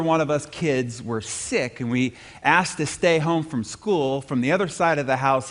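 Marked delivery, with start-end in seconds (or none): none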